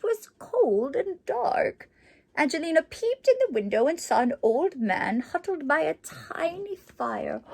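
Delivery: lively storybook narration; reading to children. A narrator's reading. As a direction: read it like a bedtime picture book. Reads the book aloud.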